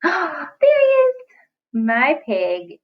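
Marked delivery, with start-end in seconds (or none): 0.0-1.1 gasp, then high, slow delighted cry; mock amazed discovery; delighted surprise. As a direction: act thrilled to find him for the kids. Teacher finds the pig picture.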